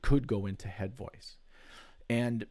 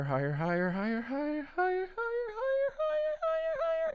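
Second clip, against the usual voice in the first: each higher pitched up step by step into falsetto; rising into falsetto. Shown as a demonstration. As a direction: climb "higher" up into a thin falsetto. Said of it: "you start talking higher".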